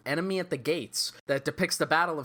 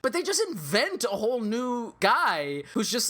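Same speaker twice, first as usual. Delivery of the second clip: usual speaking voice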